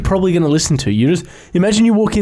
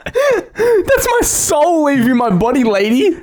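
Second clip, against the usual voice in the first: high, slow, crying wail; mock anguish; 11 semitones higher, slower than usual. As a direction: wail it like your soul is leaving. Mock agony at sound healing.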